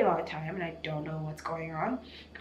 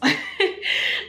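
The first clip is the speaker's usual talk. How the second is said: tearful laugh; 13 semitones higher, 7 dB louder than usual. Real, not acted. Laughing while crying.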